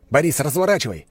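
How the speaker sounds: loud urgent command; shouting. A narrator's reading. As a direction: yell at the driver to turn. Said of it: "крикнул я".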